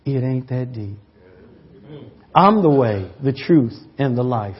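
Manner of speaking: very soft and low, fading; soft whisper. Retold or imitated. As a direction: murmur it tenderly. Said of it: "He whispered in his ear".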